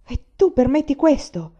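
furious challenge. A narrator's reading. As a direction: confront him, outraged and loud. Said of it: "corse infuriato da Enlan".

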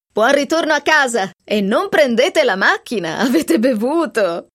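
loud, jovial joke; host jokes to departing guests, loud and jovial; joking. Acted. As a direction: boom out the joke with a grin. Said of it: "scherzò".

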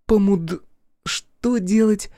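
high, slow, puzzled question; baffled. A narrator's reading. Girl fails to understand an odd word, baffled. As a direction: stumble on the word, then ask. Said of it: "не поняла Оли".

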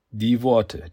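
ordinary delivery

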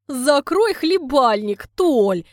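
a woman laughing off her suitor, rough and loud; laughing rebuke. Acted. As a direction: laugh while telling him to shut up. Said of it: "смеётся Люда густым голосом".

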